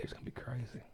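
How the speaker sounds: breathy stage whisper. Acted. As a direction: whisper it like a secret. Teasing upcoming stories.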